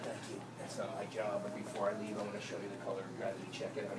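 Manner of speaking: very low, soft voice; calm reply. Retold or imitated. Leaving the kid with a challenge, calm quiet reply. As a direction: answer the heckler quietly and evenly.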